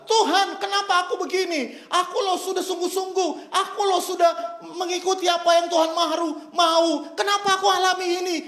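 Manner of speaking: preacher mimicking a believer complaining to God. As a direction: whine at God like a wronged churchgoer.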